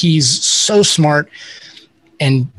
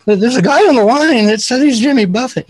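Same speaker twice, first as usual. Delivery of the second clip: high, timid-intern impression; little intern; 8 semitones higher than usual. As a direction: play a junior intern nervously passing on a call. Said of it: "some little intern was like".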